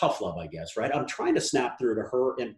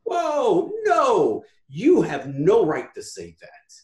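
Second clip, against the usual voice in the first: high, loud retold scolding, slowing to a firm finish; stern rebuke; 5 semitones higher, 4 dB louder, much slower than usual. Host retelling how he scolded a young mentee, loud and stern. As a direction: cut her off hard, then lay it down slowly. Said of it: "I'm like".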